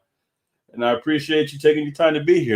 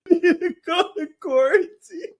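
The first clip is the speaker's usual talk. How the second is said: high, slow line broken by real laughter; laughing through words. Real, not acted. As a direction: say it while cracking up. Laughing while imitating [name].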